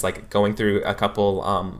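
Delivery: ordinary talk, nothing marked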